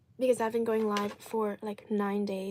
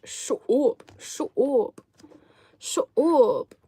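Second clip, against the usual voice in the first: put-on loud, high Irish-accented shut up; 3 semitones higher, 8 dB louder, much slower than usual. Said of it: "I'd say in Irish".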